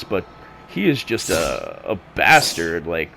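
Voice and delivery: usual speaking voice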